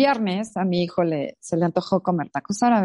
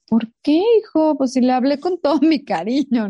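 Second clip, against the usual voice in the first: surprised protest; 6 semitones higher, 4 dB louder than usual. Retold or imitated. Mother defends herself to son.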